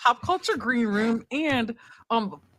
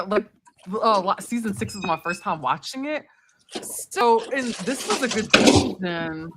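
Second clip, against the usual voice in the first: normal talking voice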